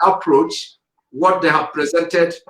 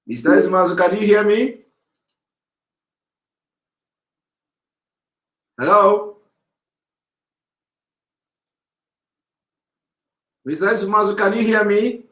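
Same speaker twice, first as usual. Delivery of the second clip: raised, higher call to a caller who may not hear; 6 semitones higher than usual. Real, not acted. Host calling out to a caller lost on the line, raised and higher. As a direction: call out to check the line is live.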